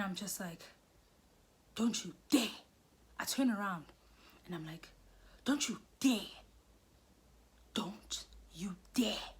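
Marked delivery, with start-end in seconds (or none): 1.7-2.6 acted warning. Turning on the man chasing her, punchy acted warning. 5.4-9.3 spaced-out threat, the second take slower; dramatic warning. Retold or imitated. Turning round on the boyfriend, slow dramatic warning. Turns round and warns him.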